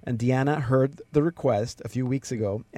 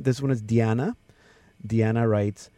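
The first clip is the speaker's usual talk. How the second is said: usual speaking voice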